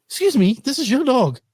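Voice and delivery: high, affronted excuse me; high, affronted answer to the wife's complaint; indignant retort. Retold or imitated. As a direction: act offended at being blamed. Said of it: "I'm like".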